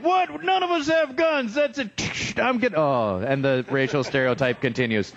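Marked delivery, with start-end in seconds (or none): 0.0-2.7 high, outraged protest; mock indignant American. An offended American viewer online, high mock outrage. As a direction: sputter it defensively. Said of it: "one American's like".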